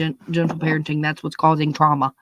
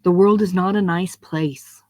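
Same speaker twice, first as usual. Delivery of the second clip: usual speaking voice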